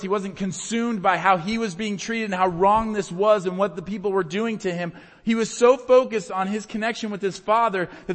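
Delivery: loud, high, forceful sermon surge; impassioned preaching. Real, not acted. Preacher telling of Jesus's focus on his Father, impassioned. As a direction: drive the point home at full force.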